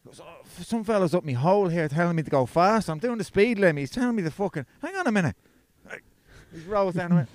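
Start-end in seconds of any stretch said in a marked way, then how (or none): 0.2-5.3 higher, worked-up phone voice; agitated retelling. Retold or imitated. Mate complains on loudspeaker about a tailgater, agitated. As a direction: act out a flustered driver venting.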